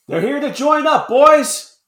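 high loud call to the troops; rally the men with the news. Acted. Soldier calls out to his men.

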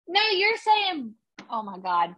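normal talking voice

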